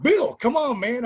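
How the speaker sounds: high, loud "come on"; urging a reluctant friend, high and loud; pleading. Retold or imitated. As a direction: beg him to go along. Begging Bill to agree.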